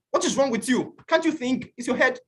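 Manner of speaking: high-pitched mock scolding; imitating his own angry outburst; retold shout. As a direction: snap at the classmates in high irritation. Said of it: "Then I shouted at them".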